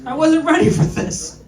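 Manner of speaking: cracking up on the repeat; laughing repeat. Shown as a demonstration. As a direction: laugh it out the second time.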